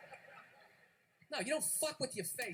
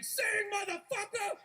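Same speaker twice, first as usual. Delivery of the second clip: high, drawn-out slow yell; mock scream; 8 semitones higher, slower than usual. Father forcing his kids to sing, high mock scream. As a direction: scream it like a tyrant stage dad. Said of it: "fifty years of screaming".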